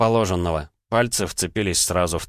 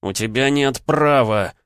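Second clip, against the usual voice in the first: slow, loud, higher angry growl; Leonid's growl; 6 semitones higher, 4 dB louder, slower than usual. Acted. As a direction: growl it through rage. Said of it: "прорычал Леонид".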